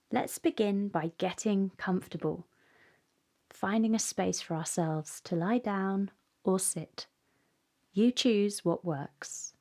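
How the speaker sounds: calm, unhurried meditation voice; guided meditation. Acted. Guide helping children settle and close their eyes, calm meditation. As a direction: ease the listener gently into stillness, pausing between phrases.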